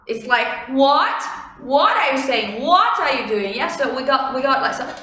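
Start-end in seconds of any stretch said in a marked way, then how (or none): 0.7-3.5 "What" swept sharply upward; incredulous-style question with "What" gliding up; questioning rise. Shown as a demonstration. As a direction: ask "What" with a rising, questioning tone. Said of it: "raising intonation in this tone".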